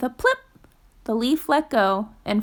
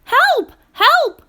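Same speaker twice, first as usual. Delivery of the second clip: very high, loud cry for help; cried for help; 11 semitones higher, 6 dB louder than usual. Acted. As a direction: shout it out in alarm. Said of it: "cried Fletcher".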